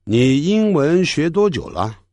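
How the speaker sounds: ordinary delivery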